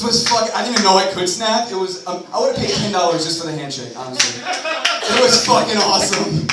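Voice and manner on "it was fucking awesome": slow, louder, higher gush of delight; drawn-out excited rave. Real, not acted. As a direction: stretch it out, thrilled. Enthusing about handshake.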